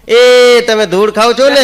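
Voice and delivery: put-on loud, high, slow kid's yell; child's shout. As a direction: shout it out like a child catching someone. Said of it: "મારા છોકરા રાડ પાડી કે". (ઊંચા અવાજે બાળકની બૂમ)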